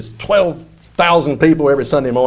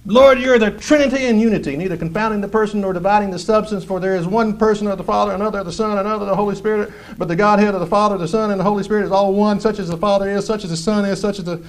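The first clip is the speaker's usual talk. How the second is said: mock creed recital; 4 semitones higher, faster than usual. Preacher parodies Peter reciting a creed, fast rote rattle. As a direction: recite like a rote catechism at speed. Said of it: "And Peter said".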